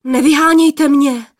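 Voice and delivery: startled cry; frightened plea. Acted. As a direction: beg them not to chase him off. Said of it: "lekl se poplašený mládenec".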